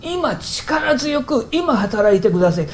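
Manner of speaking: imitating an urgent prayer, high and drawn out. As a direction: plead slowly with mock fervour, starting high. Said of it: "クリスチャンの祈りの中にそんな祈りが".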